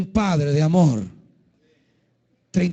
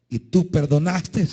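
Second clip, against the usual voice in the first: ordinary talk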